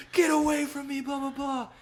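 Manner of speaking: very high mock terror; retold freak-out. Retelling him staring at the room corner, mock freak-out. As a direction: fend it off in a high imitated panic. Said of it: "he's just freaking out".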